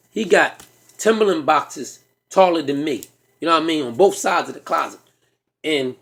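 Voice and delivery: ordinary talk, nothing marked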